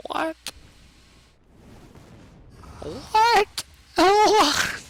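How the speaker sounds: high squeaky stunned what; shocked squeal. Real, not acted. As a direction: squeal in disbelief. Shocked and laughing at play.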